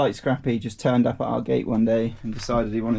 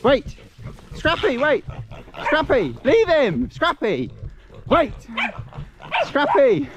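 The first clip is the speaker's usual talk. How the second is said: calling out; 13 semitones higher, slower than usual. Real, not acted. Calling dog away from boar.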